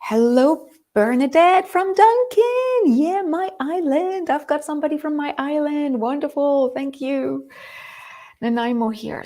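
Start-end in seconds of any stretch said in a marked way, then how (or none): 0.0-7.5 thrilled greeting. Real, not acted. Thrilled at viewer from her island.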